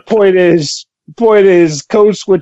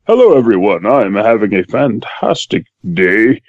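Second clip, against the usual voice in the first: low, measured, debonair gentleman; dignified voice; 9 semitones lower than usual. Shown as a demonstration. Co-host greeting the listeners after code switching, dignified. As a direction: put on a deep, dignified, debonair voice. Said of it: "That's a dignified voice. That's, that's debonair".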